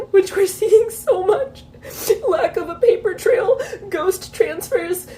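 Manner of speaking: tearful, talking through sobs; real crying. Real, not acted. Reporting detainee warnings to viewers, crying. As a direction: keep talking through the tears. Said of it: "I can't stop crying".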